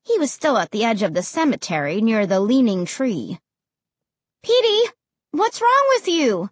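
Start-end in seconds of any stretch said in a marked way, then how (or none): 4.4-6.5 high, worried call; raised, high-pitched calling; calling to a dog. A narrator's reading. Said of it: "I called to the dog".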